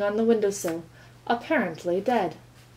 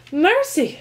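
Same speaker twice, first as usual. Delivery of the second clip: high, loud gasp of alarm; shocked cry; 7 semitones higher, 5 dB louder than usual. A narrator's reading. Mother finding her son freezing in fridge cries out. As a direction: cry out in dismay. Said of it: "she cried".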